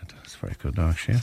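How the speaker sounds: breathy whisper; mock secret whisper; whispered aside. Aside whispered about the joke.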